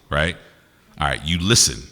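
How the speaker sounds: normal talking voice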